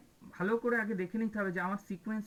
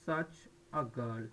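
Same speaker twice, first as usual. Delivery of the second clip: slow model phrase; 8 semitones lower, 3 dB quieter, much slower than usual. Shown as a demonstration. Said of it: "যেমন আমরা বলি না".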